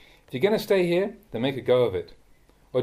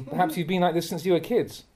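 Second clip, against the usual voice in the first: usual speaking voice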